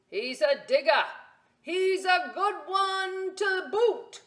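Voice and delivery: loud, high pirate shout, slowing; pirate yell. Acted. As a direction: call to the crew like a proud captain. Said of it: "then yelled back to his crew".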